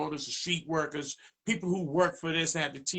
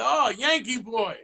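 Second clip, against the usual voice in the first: teasing nickname; 6 semitones higher, 4 dB louder than usual. Retold or imitated. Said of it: "He said".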